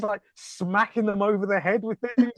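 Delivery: usual speaking voice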